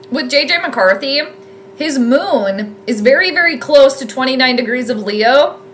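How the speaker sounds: ordinary talk, nothing marked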